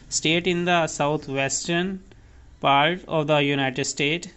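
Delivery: the state of the Arizona said word by word, very slowly; very slow dictation. Shown as a demonstration. Teacher slowly dictates the meaning to students. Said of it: "dictating definition".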